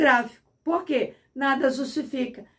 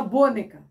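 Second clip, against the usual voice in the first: "boneca" wrongly stressed on the O, BÔ-ne-ca; "boneca" said as a proparoxytone, stressed BO; stress on first syllable. Shown as a demonstration. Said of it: "senão a tonicidade seria no O".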